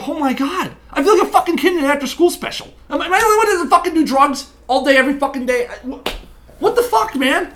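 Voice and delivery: high-pitched, fast incredulous rant; exasperated disbelief. Retold or imitated. Man venting to co-host about drug-using dates, exasperated disbelief. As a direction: rattle it off high, half laughing in disbelief.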